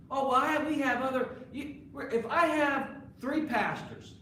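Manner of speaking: hammed-up defensive minister; mock excuse. Preacher mimicking ministers who claim other pastors, mocking.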